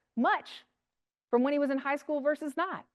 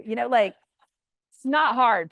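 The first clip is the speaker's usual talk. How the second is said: ordinary delivery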